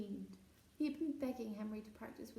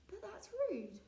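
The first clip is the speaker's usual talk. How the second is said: shocked protest; 10 semitones higher, slower than usual. Acted. Peter shocked by rudeness.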